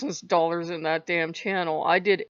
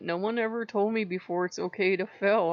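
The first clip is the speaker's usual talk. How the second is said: ordinary talk, nothing marked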